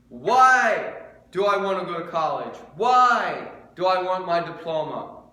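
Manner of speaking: loud and high, slow on the questions; fervent preaching. Acted. Pastor urging listeners to question their motives, preaching loud. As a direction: call the questions out to the room.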